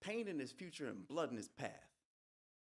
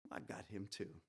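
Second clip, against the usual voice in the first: usual speaking voice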